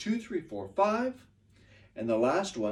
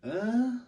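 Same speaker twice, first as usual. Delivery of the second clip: "Uh" lifted high like a question; rising question tone; 9 semitones higher than usual. Shown as a demonstration. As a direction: hum "Uh" up high, as if asking. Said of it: "my tone goes".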